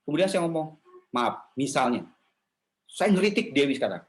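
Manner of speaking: ordinary delivery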